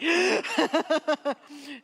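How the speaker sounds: loud high laugh bursting out. Real, not acted. Laughing loudly at her own punchline. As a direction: let a big laugh burst out.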